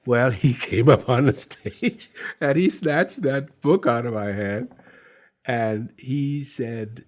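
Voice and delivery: ordinary talk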